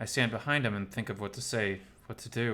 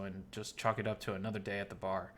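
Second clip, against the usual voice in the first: ordinary delivery, nothing marked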